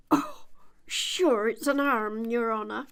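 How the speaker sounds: Irish lilt on "arm"; Irish servant answering the rabbit; Irish accent. A narrator's reading. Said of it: "He pronounced it arm".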